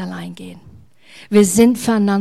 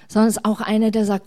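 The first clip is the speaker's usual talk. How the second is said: normal talking voice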